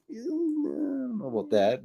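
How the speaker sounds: high, slow, drawn-out reluctance; reluctant hedging. Retold or imitated. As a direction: stall in a high voice, unwilling to commit. Hesitant reply to prisoner's invitation.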